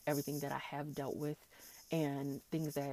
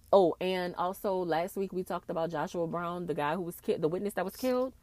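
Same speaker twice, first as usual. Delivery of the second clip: usual speaking voice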